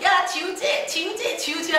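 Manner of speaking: put-on high sing-song kids' teasing; mocking taunt. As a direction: tease him in a high singsong. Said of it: "하고 놀려댔어요". (놀리는 말투)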